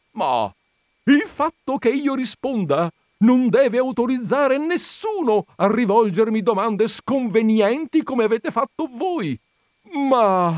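high, loud outraged tirade; indignant shouting. A narrator's reading. Countess ordering the impudent visitor out, indignant shouting. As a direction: scold him and show him out. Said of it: "gridò".

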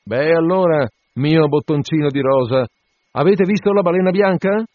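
high, loud hailing question; calling out. A narrator's reading. Sailor asking the Frenchman about the whale, calling out. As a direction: pitch it up to carry across the water. Shouted questioning between two ships.

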